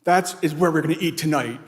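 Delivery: mock-commanding, punched out loudly; her emphatic declaration. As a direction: imitate someone declaring it, no argument allowed. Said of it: "the way she said it".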